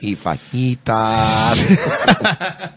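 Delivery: hammed-up Mexican accent, stretching out the last word; mock Mexican accent. Podcaster mocks a Mexican American vendor in a hammed-up Mexican accent. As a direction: play a food-truck owner in a thick mock Mexican accent. Mocking a Mexican food-truck owner.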